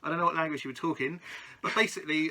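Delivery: normal talking voice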